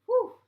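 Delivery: loud high sigh of relief; relieved whew. A narrator's reading. BB relieved her homework was not lost. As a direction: blow out relief. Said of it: "B B said".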